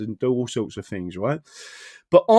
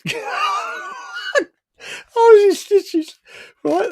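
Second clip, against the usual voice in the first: laughing; 5 dB louder, much slower than usual. Real, not acted. Recalling watching funny video.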